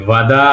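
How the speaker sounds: "ভাদা" slow and low with initial v, last a drawn out; V sound. Shown as a demonstration. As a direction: stretch "ভাদা" as va-daa, rising at the end. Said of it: "ভয়ের মতো উচ্চারণ।".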